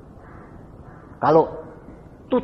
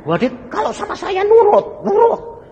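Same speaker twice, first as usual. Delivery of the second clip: high mocking; 8 dB louder than usual. Mocking the wife's bounce-back.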